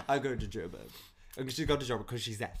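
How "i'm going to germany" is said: mock South African accent. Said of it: "she was from South Africa".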